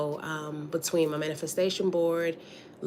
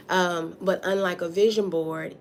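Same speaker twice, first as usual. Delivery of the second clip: ordinary delivery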